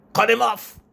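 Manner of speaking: very high, loud alarm; a shrill urgent cry; panicked shout. Acted. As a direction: yell to stop the broadcast. Panicked order to stop broadcast.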